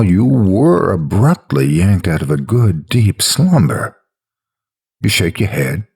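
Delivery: usual speaking voice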